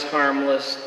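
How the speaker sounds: ordinary talk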